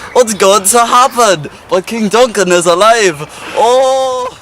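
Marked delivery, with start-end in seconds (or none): 3.5-4.4 high startled "Oh!"; surprised gasp. Acted. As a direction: blurt it in sudden shock. Macbeth startled by news.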